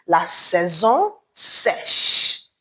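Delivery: "La saison sèche" said slowly, stressing "saison"; drawn-out teacher pronunciation of "La saison sèche"; slow French model. Shown as a demonstration. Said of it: "In French, we say".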